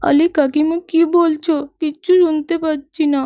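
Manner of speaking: normal talking voice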